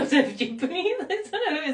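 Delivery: giggly, high-pitched laughing talk. Real, not acted. Amused at her own crude joke, laughing.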